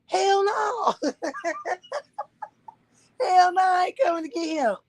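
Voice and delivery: high, laughing hell no; cackling refusal over the phone; laughing mock shout. Mother refusing to fetch dad with a laughing shout.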